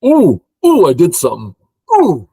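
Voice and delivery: loud high swooping oohs; excited whooping. Real, not acted. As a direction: whoop with real delight. Thrilled by own move mid-game.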